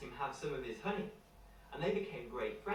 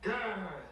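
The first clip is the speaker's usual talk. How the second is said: a playful storybook growl, higher and a bit louder; bear growl; 6 semitones higher, 5 dB louder than usual. Acted. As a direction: growl like a friendly bear for kids.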